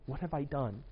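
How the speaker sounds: dismayed hush. Retold or imitated. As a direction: murmur it like a quiet regret. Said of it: "you'll be like".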